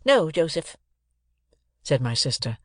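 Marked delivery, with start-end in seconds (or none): 0.0-0.8 higher, reproachful correction. A narrator's reading. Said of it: "still in a reproachful manner".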